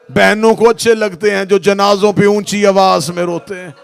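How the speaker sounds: very loud, high wailing preaching over music; wailing sermon. Acted. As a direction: wail the tragedy to the crowd at full force. Majlis wailing at full force.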